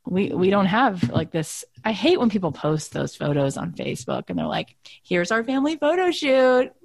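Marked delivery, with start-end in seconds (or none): 5.0-6.7 mock-sweet boast, pitched up and drawn out; mocking show-off. Wife mocking people who post family photos, sing-song parody. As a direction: imitate a smug photo post, stretching the words.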